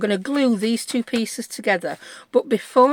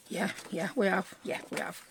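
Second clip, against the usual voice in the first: quiet; 12 dB quieter than usual. Real, not acted. Muttering to self while checking.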